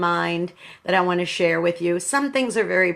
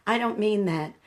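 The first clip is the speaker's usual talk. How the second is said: normal talking voice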